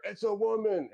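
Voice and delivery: high-pitched mock yell of alarm; put-on high voice, freaking out; yelling townsperson. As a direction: cry it out high, like a panicked local. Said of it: "you hear another one yell".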